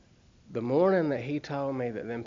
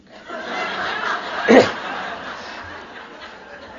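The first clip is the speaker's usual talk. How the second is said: wheezing laugh; 9 semitones higher than usual. Real, not acted. Laughs after nine o'clock.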